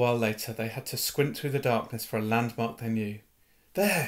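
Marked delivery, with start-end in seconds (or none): 3.7-4.1 a higher, mild read-aloud shout. Acted. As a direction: call out the landmark, pitched up but not much louder. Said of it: "shouted Harry".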